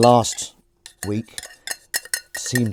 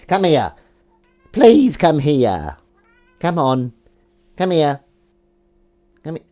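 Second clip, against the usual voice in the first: higher, louder coaxing; cat voice; 5 semitones higher, 8 dB louder, slower than usual. Real, not acted. Coaxing Willow to come over, high cat voice. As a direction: coax her over, sing-song. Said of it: "he hates this voice".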